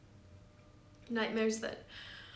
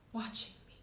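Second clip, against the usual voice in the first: whispering; 8 dB quieter than usual. A narrator's reading. Presence watching her.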